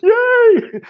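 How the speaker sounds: high, loud joyful cheer; cheering yay. Retold or imitated. Healer cheering on a client, a high loud yay. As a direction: squeal it out with delight.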